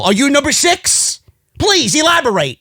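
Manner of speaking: high-pitched sarcastic questioning; mock questioning. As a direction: pitch it up, feigning curiosity to needle her.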